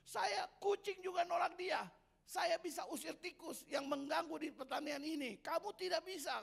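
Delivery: high, loud scornful cat. Acted. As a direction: sneer at the puppy as the cat. Cat rejects the dog.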